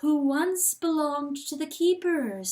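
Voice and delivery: lightly voiced looming presence; a hint of a gloating voice. A narrator's reading. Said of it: "said the voice".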